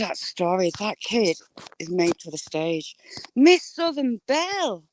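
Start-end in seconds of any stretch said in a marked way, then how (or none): 3.3-4.8 delighted greeting. Real, not acted.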